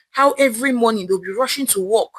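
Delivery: ordinary delivery, nothing marked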